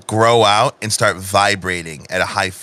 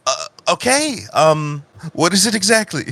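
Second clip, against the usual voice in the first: slightly hesitant, higher-pitched reply; Eve, taken aback; 6 semitones higher than usual. Acted. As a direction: respond warily to a sudden outburst. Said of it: "You see Eve just be like".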